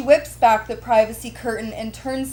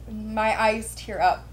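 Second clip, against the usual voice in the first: ordinary delivery, nothing marked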